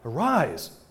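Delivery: loud, commanding call; forceful command. A narrator's reading. Jesus commanding the dead girl to rise, loud and forceful. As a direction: raise her with a forceful order. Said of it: "spoke forcefully".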